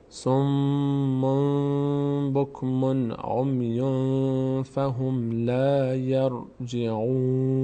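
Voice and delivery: slow, drawn-out recitation on a level pitch; sustained, unhurried verse reading; Quran recitation. Shown as a demonstration. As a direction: recite the verse measuredly, holding nasals and long vowels. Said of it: "این آیه رو بخونیم با هم".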